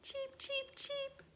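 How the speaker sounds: tiny newborn chick voices; high soft cheeping; chick cheeps. Acted. Baby chicks hatching.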